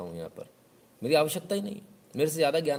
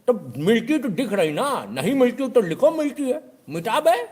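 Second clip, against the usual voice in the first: much higher, louder, slow at first then yelling; mock shout; 11 semitones higher, 8 dB louder, slower than usual. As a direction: build to a scornful yell. Customer ordering last words erased.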